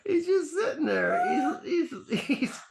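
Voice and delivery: high, slow, laughing through words; words squeezed out between laughs. Real, not acted. Still laughing at the show.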